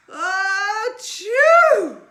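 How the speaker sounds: huge shouted sneeze; loud sneeze. Acted. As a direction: let out a giant achoo. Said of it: "The bear says".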